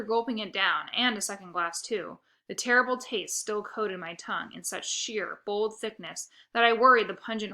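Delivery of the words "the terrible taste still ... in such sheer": plain reading voice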